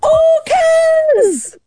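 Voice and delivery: high, long drawn-out call; mock shout. Crew shouting that orcas are near, mock shout. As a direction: imitate a crowd calling out a sighting, high-pitched. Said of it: "everybody shouts".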